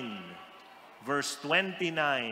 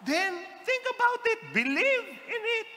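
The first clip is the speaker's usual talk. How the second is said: high, quick urging to believe; high exhortation. Acted. Preacher to congregation, high urgent exhortation. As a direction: urge them upward, high and fast.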